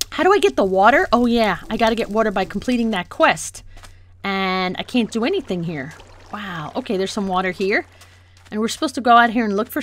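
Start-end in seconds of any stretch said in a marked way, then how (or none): none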